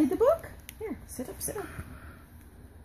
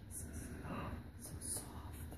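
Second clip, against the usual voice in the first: hushed whisper; 19 dB quieter than usual. Real, not acted. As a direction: whisper it with a little gasp of wonder. Softly reading touch book to child.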